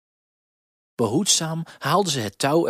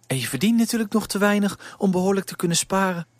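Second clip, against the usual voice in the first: lightly voiced motherly woman, higher; knowing, sympathetic remark; a hint of a kind baker's wife; 7 semitones higher, faster than usual.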